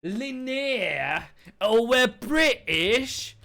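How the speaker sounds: slow, loud hammed-up British "we're British?"; mock British accent. Streamer mocking the British pronunciation in a hammed-up British accent. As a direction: camp up a fake Queen's English. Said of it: "Queen's English".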